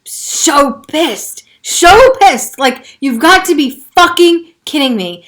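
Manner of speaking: real anger. Real, not acted. Venting to viewers about her pain, real anger. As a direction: let the frustration burst out. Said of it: "I feel emotional about it right now".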